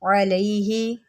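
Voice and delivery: अलैहि said with a clear ayn sound; أَلَيْهِ read as अलैहि, slowly and carefully; correct Quranic reading. Shown as a demonstration. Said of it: "इसको आप पढ़ेंगे अलैहि".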